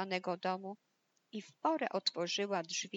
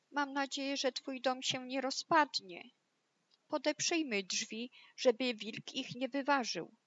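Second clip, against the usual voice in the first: high, worried piggy voice; frightened little pig; 5 semitones higher than usual. Acted. Fleeing pig to brother behind the door, high worried voice. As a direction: speak up high with a touch of fear. Frightened pigs hide from the wolf.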